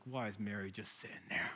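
resentful muttering. Acted. Martha grumbling alone in the kitchen, resentful. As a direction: grumble under your breath, drawing out the jab. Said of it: "she's, she's riled".

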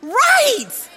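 loud and high, sharing the sting; exclaimed. Real, not acted. Preacher to the congregation, loud exclamation. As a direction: shout it as the punchline. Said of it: "That's exactly how I felt".

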